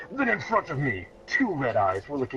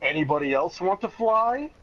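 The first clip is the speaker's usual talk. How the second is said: high, smug dare; a cocky high challenge; cocky taunt; 7 semitones higher than usual. Acted. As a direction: taunt the enemy with a grin. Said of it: "Then I said".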